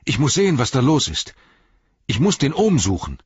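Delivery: high, loud, urgent cry; urgent alarm. Acted. Sam, frantic about the Gaffer, cries out high and loud.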